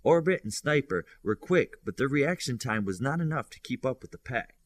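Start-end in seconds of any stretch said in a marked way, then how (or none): none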